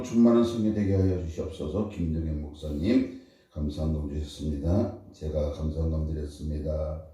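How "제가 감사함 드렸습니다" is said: normal talking voice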